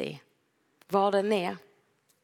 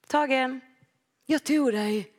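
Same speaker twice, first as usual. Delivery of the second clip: put-on playful child's tagging call, higher and louder; child at tag; 6 semitones higher, 3 dB louder, slower than usual. Preacher acts a child catching a friend at tag, playfully. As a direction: crow it like a kid who caught you.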